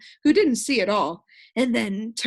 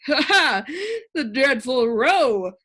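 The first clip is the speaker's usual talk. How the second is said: louder, eager and slow; bright; 4 semitones higher, 6 dB louder, slower than usual. A narrator's reading. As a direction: chime in brightly, pleased. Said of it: "volunteered the humbug brightly".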